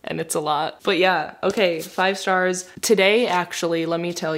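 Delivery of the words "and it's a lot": ordinary talk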